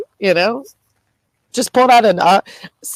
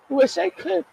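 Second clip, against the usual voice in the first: high, softer tearful voice; small tearful voice, pitched up; crying; 7 semitones higher, 4 dB quieter than usual. Real, not acted. Admitting to viewers a wish to disappear, crying. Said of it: "'cause I'm crying".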